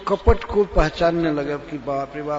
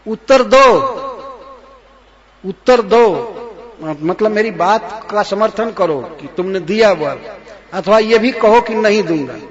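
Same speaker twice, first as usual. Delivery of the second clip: shouting demand; 3 semitones higher, 7 dB louder than usual. Acted. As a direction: yell at the king to answer. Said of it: "कहती है कि". (ऊँची चीख़ में जवाब की माँग)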